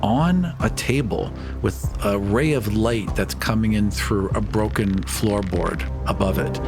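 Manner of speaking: ordinary talk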